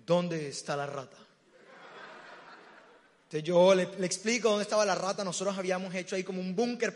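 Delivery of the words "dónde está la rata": low-pitched swaggering hero entrance; cocky rat hunter. Retold or imitated. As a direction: play the fearless hunter, voice dropped low. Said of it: "les voy a decir con qué actitud llegó". (cazador valentón con voz grave)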